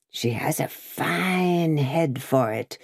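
slow, lightly voiced croak. Jacques Three to Madame, a slow mild croak. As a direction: drag the words out in a croak. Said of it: "croaked Jacques Three".

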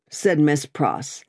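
usual speaking voice

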